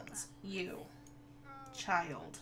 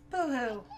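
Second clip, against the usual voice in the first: louder mock crying boo-hoo; 5 dB louder than usual. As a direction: tease the crying kid with a fake sob. Mocking crying sim child.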